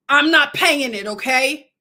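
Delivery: defiant declaration. Real, not acted. Defiant message to the government.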